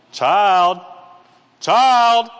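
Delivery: mock parent losing patience; mounting parental impatience. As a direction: escalate the call to a misbehaving child. Parent calling child, escalating.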